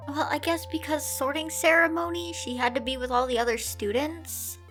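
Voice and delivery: usual speaking voice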